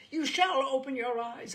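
put-on screaming at the drunk husband; screamed. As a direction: scream to wake him. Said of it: "I cried".

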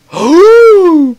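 sharp high gasp of amazement; excited high gasp. Real, not acted. Amazed at what he found.

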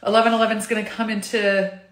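normal talking voice

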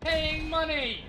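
loud, slow drawn-out shout. Real, not acted. Shouting match over swearing.

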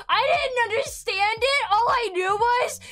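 high, loud exasperated defence; loud protest. Real, not acted. Streamer defending her childhood fear to viewers, loud protest. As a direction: plead your case at full volume.

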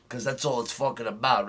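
normal talking voice

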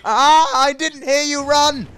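drawn-out, high outraged shout; screaming and shouting. Real, not acted. Gamer at people in this game, screaming and shouting. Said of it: "I will scream and shout".

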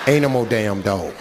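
a hint of gruff bluntness; low blunt telling-off. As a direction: lay it on her, low and deadpan. Teller tells her off, low.

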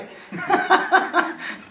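high breathy burst of laughter; real laugh. Real, not acted. Preacher laughs at his dog joke with the devotees, real laughter. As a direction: laugh out at your own joke.

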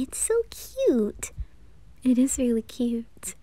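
soft whisper over a cute keychain; whispered cooing. Acted. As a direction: breathe it out, charmed. Admiring the keychain.

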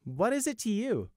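guarded, pointed query; suspicious. A narrator's reading. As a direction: question his motive with suspicion. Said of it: "Sunshine asked suspiciously".

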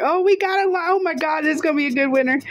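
high-pitched rush of excitement; sudden thrilled outburst; real excitement. Real, not acted. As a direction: blurt it out high, thrilled at a winning line. Sudden sight of a winning line.

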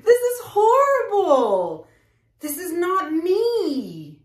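high, slow fake sobbing with a laugh; hammed-up wail over the mess; mock crying. Artist to viewers over the ruined canvas, a high hammed-up sob.

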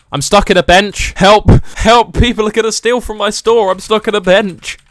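loud, high mock panic; panicked call for help. Shopkeeper stuck in a bench calling out, mock panic. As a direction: yell for rescue, playfully desperate.

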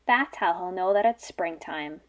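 ordinary talk

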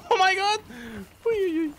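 high, laughing shriek; laughing squeal. Real, not acted. Laughing at teammate's death.

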